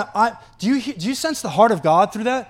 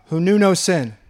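ordinary talk

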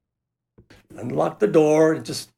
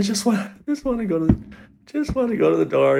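halting, higher-pitched plea; acted-out frightened begging; tearful pleading; 7 semitones higher, faster than usual. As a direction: beg in broken starts, near tears. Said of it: "I started to cry".

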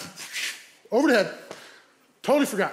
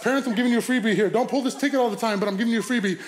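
usual speaking voice

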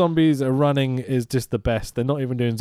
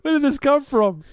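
high, laughing delight; 14 semitones higher than usual. Retold or imitated. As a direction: crack up while saying it. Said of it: "you're like".

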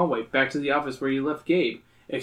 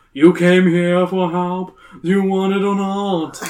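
loud, slow, impatient; 5 semitones higher, 7 dB louder, slower than usual. Acted. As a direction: push them impatiently. Carmine snapping, loud.

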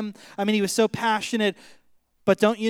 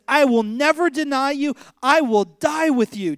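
Peter's fervent vow, higher and louder; raised, insistent pledge; emphatic vow; 6 semitones higher, 5 dB louder than usual. Retold or imitated. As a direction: swear loyalty with full conviction. Peter's vow acted out to Jesus.

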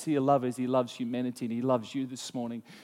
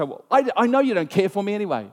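higher, louder hurt accusation; sulky accusation; 6 semitones higher, 6 dB louder than usual. Retold or imitated. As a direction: blurt out a wounded grievance.

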